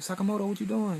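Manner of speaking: high, urgent call across the jungle; high-pitched retold shout of alarm. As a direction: yell his name to stop him. Tyra calling out in retold scene.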